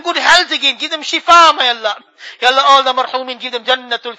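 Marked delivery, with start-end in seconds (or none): none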